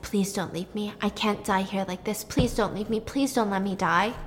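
quiet, dying plea; pleading. Retold or imitated. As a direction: beg softly as if fading. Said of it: "and he said".

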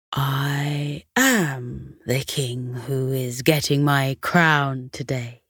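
low, slow kindly king voice; gentle king. Acted. The king gently answering the startled dogs, slow and low. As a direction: speak as a calm, gracious king, slowly.

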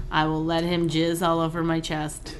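normal talking voice

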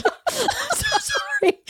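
very high, slow, laughing apology; laughing squeal. Real, not acted.

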